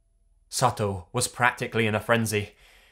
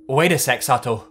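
loud, higher urgent call; calling out urgently; 4 semitones higher, 8 dB louder than usual. A narrator's reading. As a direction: shout after someone running off. Calling after fleeing coworker.

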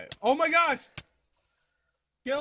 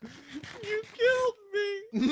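anguished whine; 11 semitones higher, much slower than usual. Real, not acted. Dies, wails at friend.

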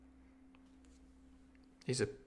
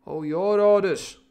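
slow, high and loud reply; loud retort; 7 semitones higher, 8 dB louder, slower than usual. Acted. Crewman answering the captain's orders, loud retort. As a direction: throw it back loudly, drawn out.

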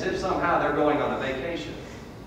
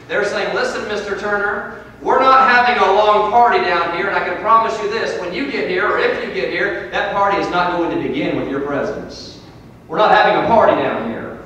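raised, loud pulpit warning; forceful preaching; 8 semitones higher, 11 dB louder than usual. Acted. Warning Mister Turner as those in hell, forceful preaching. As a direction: preach it hard, voice lifted.

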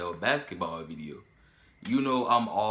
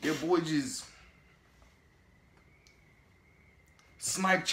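hyped higher voice; 8 semitones higher than usual. Real, not acted. Hyping up his boy's move to Lando, gleeful gloating. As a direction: gloat over the ankle-breaker. Hyped over the ankle-breaker.

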